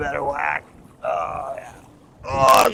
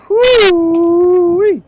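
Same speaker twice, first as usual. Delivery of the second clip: high, loud drawn-out whoop; excited whoop; 13 dB louder than usual. Real, not acted. Angler hollering over the fish, excited whoop. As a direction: let out a joyful holler. Celebrating big catch.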